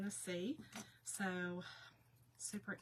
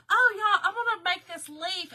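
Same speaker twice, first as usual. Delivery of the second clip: high, loud, thrilled cry about the leaf; excited self quote; 9 semitones higher, 14 dB louder than usual. Retold or imitated. Crafter quoting herself about to cut a leaf, thrilled. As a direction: burst out with crafting joy, much higher and louder. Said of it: "I was like".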